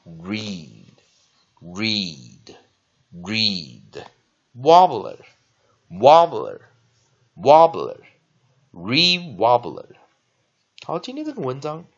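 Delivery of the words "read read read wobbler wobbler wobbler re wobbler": very slow, louder model words with pauses between; teacher's drawn-out pronunciation drill; slow repeat-after-me drill. Shown as a demonstration. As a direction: draw each word out for students to copy. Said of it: "再跟老师念一遍".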